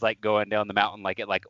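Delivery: ordinary delivery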